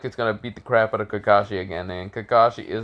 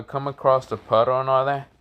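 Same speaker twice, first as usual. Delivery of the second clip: usual speaking voice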